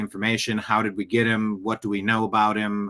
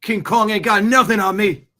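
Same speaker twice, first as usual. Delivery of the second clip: high, loud swaggering movie shout, imitating; Denzel impression; 8 semitones higher, 6 dB louder than usual. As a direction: bellow it like the famous film cop. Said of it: "watching a Denzel clip".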